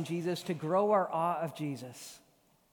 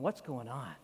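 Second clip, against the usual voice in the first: softer and lower, a puzzled question; hushed wondering; 6 semitones lower, 6 dB quieter than usual. Retold or imitated. The Philippians wondering about Paul, hushed puzzled question. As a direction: murmur it in quiet amazement. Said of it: "Just like the Philippians were going".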